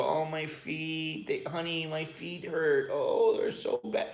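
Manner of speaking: high, slow, drawn-out whine; hammed-up moan for sympathy; mock whining complaint. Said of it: "if I was to call her and go".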